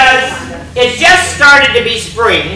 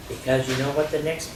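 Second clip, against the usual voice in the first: ordinary delivery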